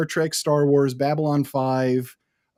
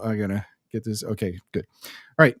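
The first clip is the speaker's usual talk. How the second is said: ordinary talk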